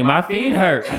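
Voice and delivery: high, loud mock whine; playful imitated griping; whining complaint. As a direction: whine to granny like a sore kid. Child complaining to granny.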